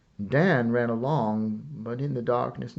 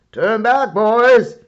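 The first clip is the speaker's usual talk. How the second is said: loud, high shouted order; cried out; 11 semitones higher, 14 dB louder, slower than usual. A narrator's reading. Cavalry officer shouting to halt his charge. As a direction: call the troops back hard. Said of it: "he cried".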